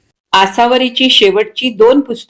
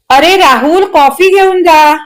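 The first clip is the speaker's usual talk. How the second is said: calling out; 8 semitones higher, 3 dB louder than usual. A narrator's reading. Mother calling her son back for coffee, calling out. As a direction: call after him across the house.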